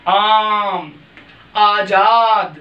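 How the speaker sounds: आजाद with the आ stretched very long, slowly; drawn-out long aa. Shown as a demonstration. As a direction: hold the आ in आम and आजाद, lengthened. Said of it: "ऊपर खींचकर बोलेंगे तो वो दीर्घ हो जाएगा". (आ को खींचकर लंबा बोलें)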